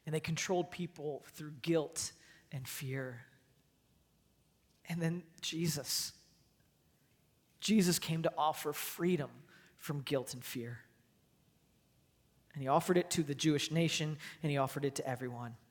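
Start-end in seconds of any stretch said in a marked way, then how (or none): none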